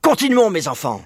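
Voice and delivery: voix plus aiguë, un peu essoufflée; haletant. A narrator's reading. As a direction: pousser ses petits en courant, à bout de souffle. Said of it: "haletait Maître Renard". (le renard presse ses enfants, essoufflé)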